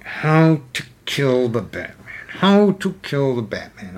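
brooding villain mumble, slow; Penguin. Retold or imitated. Penguin pacing in his lair, slow scheming villain voice. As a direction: ponder it aloud like a scheming boss. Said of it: "Penguin ... pacing ... going".

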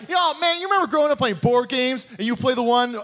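high, excited nostalgic pitch; forgetful hack comic. Acted. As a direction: hype the shared memory to the crowd. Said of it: "a guy that does that".

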